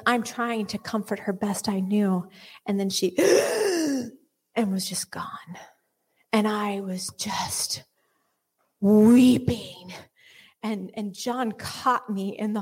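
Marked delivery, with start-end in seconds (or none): 3.2-4.1 sharp, high imitated last gasp. Retelling the dog's dying gasp, sharp gasp. 4.6-9.9 unvoiced, slow whisper; hushed grief. Real, not acted. Telling of her dog's death, whispered.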